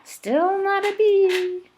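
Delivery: high, playful little-insect voice; cheeky hoverfly. Acted. Hoverfly teasing the bees, high playful voice. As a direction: tease them like a smug hoverfly. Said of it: "the hoverfly saying".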